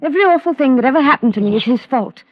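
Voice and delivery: angry outburst. Acted. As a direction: hurl it back at your mother. Daughter's outburst against mother.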